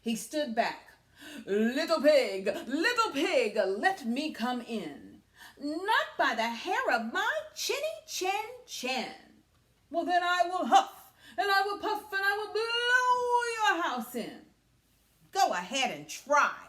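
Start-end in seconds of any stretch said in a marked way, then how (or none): 1.5-5.2 louder, laughing wolf call. Acted. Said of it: "wolf's line". 5.6-9.4 higher, louder squeaky pig refusal; defiant pig. Acted. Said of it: "pig's reply". 9.9-14.5 loud, much higher sing-song wolf threat; wolf's threat. Acted. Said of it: "wolf's threat". 15.3-16.6 louder challenging dare; defiant pig. Acted. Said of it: "said Parker".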